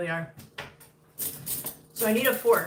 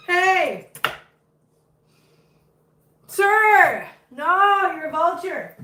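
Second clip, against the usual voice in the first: high, loud sharp calls; loud high mock scolding of a begging dog; scolding a pet; 10 semitones higher, 7 dB louder, much slower than usual. Real, not acted. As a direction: tell the pet off loudly.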